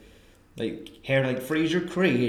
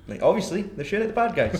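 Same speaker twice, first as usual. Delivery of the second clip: normal talking voice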